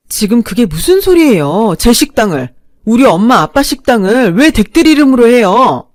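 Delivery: furious daughter-in-law, loud and high; angry outburst. Acted. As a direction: shout back in disbelief. Narrator furious, hands shaking.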